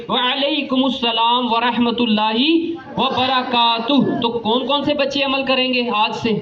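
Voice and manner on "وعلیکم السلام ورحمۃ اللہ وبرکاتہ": وعلیکم السلام ورحمۃ اللہ وبرکاتہ said slowly and fully; a slow, complete model reply; full reply. Shown as a demonstration. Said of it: "ان کا مکمل جواب دیں".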